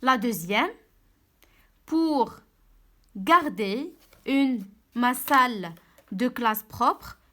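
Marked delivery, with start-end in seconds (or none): 1.9-7.2 very slow, word-by-word reading; slow read-aloud. Shown as a demonstration. Teacher reading the sentence to pupils, word by word. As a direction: stretch each word for the pupils. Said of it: "teacher reads exercise sentence".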